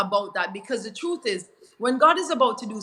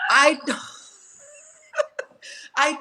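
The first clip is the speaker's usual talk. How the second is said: laughing; 6 semitones higher than usual. Real, not acted. Laughs mid-confession.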